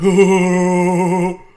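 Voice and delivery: loud, long, hooting mock bass laugh; imitated deep-ass laugh. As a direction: imitate the kid's laugh as one booming held hoot. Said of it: "he had, like, a deep-ass laugh".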